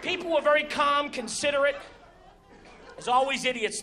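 ordinary delivery

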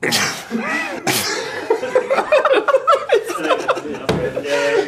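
high burst of laughter. Real, not acted. Laughing at letter.

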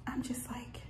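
hushed whisper, people in the house. Real, not acted. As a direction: keep it to a whisper. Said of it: "so I'm whispering because I got people".

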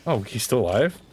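ordinary talk, nothing marked

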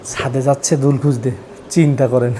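hushed aside. Real, not acted. Husband whispering to viewers about his wife searching the roof, hushed. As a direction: quietly fill the audience in on the prank.

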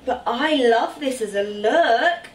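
ordinary delivery

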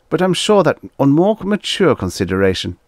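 very high, loud appeal; piping envoy. A narrator's reading. Magnan persisting in his appeal to the warriors, piping. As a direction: plead in a high, fussy diplomat's voice.

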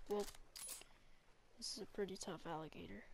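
ordinary delivery

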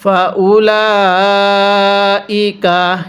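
ফাউলাইকা with lam held four alif long, very slow; slow Quranic chant; four-alif stretch. Shown as a demonstration. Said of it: "কিভাবে পড়ব?".

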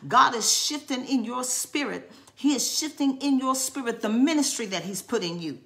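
normal talking voice

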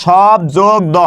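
শব যোগ দ said slowly as separate pieces; syllable by syllable. Shown as a demonstration. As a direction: split the word at শব, then দ, with a pause between. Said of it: "কিভাবে উচ্চারণ করে কোথায় কোথায় থামি". (শব যোগ দ ভেঙে ভেঙে বলা)

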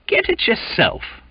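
high, surly Cockney snap; surly sailor retort. Acted. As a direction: brush him off rudely. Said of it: "he replied in a surly tone".

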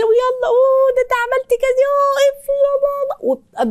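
put-on high, sing-song cooing to a child; sweet mother voice. Shown as a demonstration. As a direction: coo gently like praising a toddler. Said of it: "بتتعاملي معاه بهدوء وبسمه". (صوت أم حنون عالي)

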